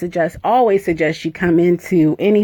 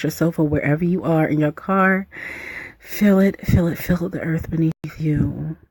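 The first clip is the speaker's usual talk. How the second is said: ordinary talk, nothing marked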